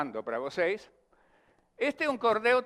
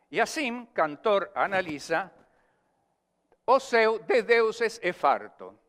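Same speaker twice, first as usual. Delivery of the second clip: slow, louder recited verse; cordel recitation; 3 semitones higher, 4 dB louder than usual. Acted. Poet reciting his cordel to the class, declaimed. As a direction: declaim the stanza like a folk poet.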